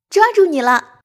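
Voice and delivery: loud, high startling yell; sudden shout. A narrator's reading. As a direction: pounce on someone with a shout. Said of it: "大叫道". (突然大叫吓人)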